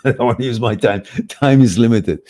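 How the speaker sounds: laughing mock excuse. A host mocking his own excuse, laughing loudly. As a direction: laugh off the chore as you say it.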